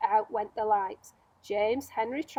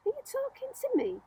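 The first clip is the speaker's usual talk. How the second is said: much higher, startled question; puzzled James; 13 semitones higher than usual. Acted. James confused about who the centipede means, high puzzled question. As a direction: ask it wide-eyed and unsure.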